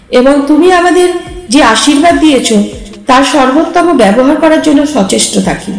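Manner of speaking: ordinary delivery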